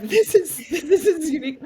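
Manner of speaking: high and loud, laughing it out. Real, not acted. To the host, laughing at the conference idea. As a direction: break into laughter mid-phrase.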